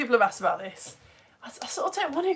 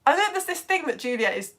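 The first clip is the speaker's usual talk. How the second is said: usual speaking voice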